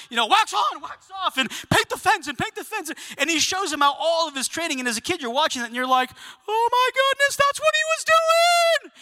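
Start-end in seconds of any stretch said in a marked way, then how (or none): 0.3-2.9 high, punchy training calls; urgent sparring commands. Acted. Miyagi barking commands while attacking. 6.5-8.9 very high-pitched excited squeal; amazed realization. Acted. Acting a kid's sudden amazed realization.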